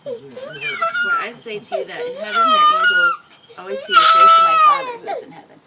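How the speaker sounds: loud, high sobbing through the words; real tears breaking through every word; crying. Real, not acted. Mother sobbing while reading scripture to her son.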